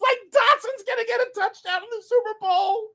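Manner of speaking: high excited quote. Retold or imitated. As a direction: sound thrilled and hopeful. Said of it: "I was like".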